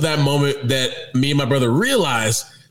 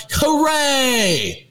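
mock kids' cheer; 10 semitones higher than usual. Kids cheering about going to camp.

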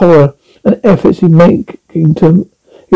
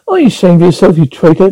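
usual speaking voice

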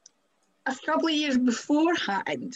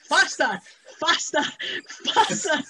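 insistent repeated command, pitch climbing; higher, punchy mock orders; mock urging; 4 semitones higher than usual. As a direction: imitate someone urging a driver on. Imitating coach urging baffled driver.

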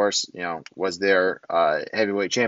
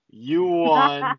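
smug quote; 12 semitones higher, 4 dB louder than usual. Retold or imitated. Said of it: "your boy Rohit says".

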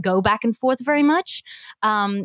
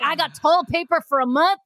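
higher-pitched mock Southern husband; Southern accent; 7 semitones higher than usual. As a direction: boast in a put-on Southern accent. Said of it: "the accent came out".

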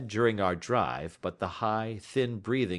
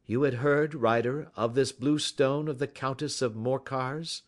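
higher, assured detective interrogation; Sherlock Holmes; 6 semitones higher than usual. Acted. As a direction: lay out the case with authority. Voices Holmes questioning.

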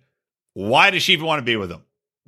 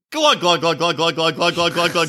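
pouring wine glugs. Retold or imitated. Imitating pouring a glass of wine.

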